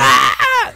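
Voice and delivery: short mock scream; imitated stabbing cry. Imitating the woman as she stabs her, mock scream. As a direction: imitate a movie stabbing shriek. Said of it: "and she's like".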